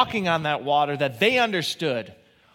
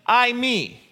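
ordinary delivery

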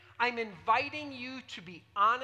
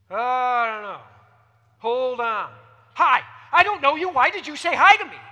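defensive stranger; 12 dB louder than usual. Retold or imitated. Mimicking defensive society person.

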